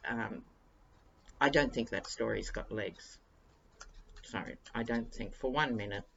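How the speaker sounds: ordinary talk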